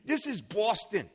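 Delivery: mock Boston accent, chin out, laughing; imitating the Boston attendant's accent. Preacher mimics Boston locals, a light Boston accent. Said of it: "I can't say it exactly like they do".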